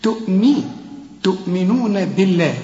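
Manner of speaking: "تؤمنون" with a clear i after the m, tu'minun; careful teaching pronunciation of "تؤمنون"; kasra on meem. Shown as a demonstration. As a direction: show "mi" first, then recite "تؤمنون بالله". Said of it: "الميم هنا مكسورة".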